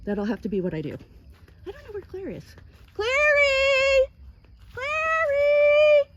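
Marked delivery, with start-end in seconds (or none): 2.9-4.1 high, loud call across the field. Real, not acted. Owner calling her donkey from far across the field. 4.7-6.1 high, loud call across the field. Real, not acted. Owner calling her donkey from far across the field.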